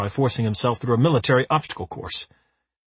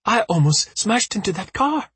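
high breathless panting line; 9 semitones higher than usual. A narrator's reading. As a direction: pant it out shaken. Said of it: "pants Parvana".

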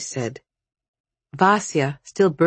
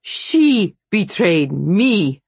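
slow, louder, emphatic retort from a witch; higher, drawn-out indignant comeback; bitter retort; 4 semitones higher, 5 dB louder, much slower than usual. A narrator's reading. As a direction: fire back, weighting each word. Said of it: "retorted the witch".

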